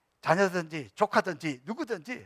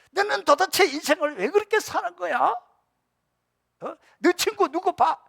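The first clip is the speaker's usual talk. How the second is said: high, loud nagging reproach; scolding father; 13 semitones higher, 8 dB louder than usual. Retold or imitated. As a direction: berate him the moment he walks in. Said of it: "비교하면 더 안 되고". (아버지가 아들을 다그치는 높고 큰 목소리)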